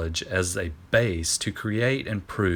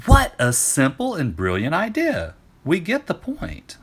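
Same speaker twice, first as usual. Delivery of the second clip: friends' chorus; 9 semitones higher, 5 dB louder than usual. Acted. Friends chorus voiced by narrator.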